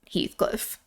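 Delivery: whispered name, unvoiced. Real, not acted.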